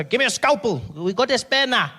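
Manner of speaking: loud, high, clueless surgeon banter; clueless doctors, loud. Retold or imitated. Surgeon fumbling over the mother on the table, loud and high. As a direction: play the doctor shouting for a scalpel, hammed up.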